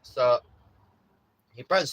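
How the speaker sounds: ordinary talk, nothing marked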